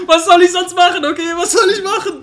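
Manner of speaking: laughing despair. Real, not acted. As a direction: whine it through laughter. High laughing lament.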